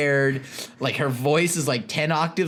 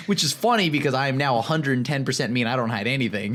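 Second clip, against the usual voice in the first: ordinary delivery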